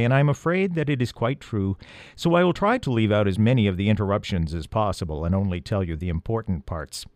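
usual speaking voice

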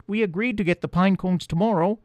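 high, tiny mouse voice; little field mouse chattering; squeaky mouse voice. A narrator's reading. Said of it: "said the first".

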